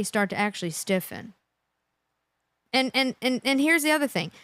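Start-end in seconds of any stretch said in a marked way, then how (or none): none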